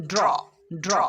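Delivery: ড্র as one joined d-r sound; d running straight into r in ড্র; blended "dr" sound. Shown as a demonstration. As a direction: model ড্র twice with a pause between. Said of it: "ড্র sound উচ্চারণ হবে".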